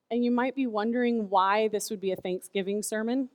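normal talking voice

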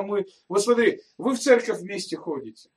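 ordinary talk

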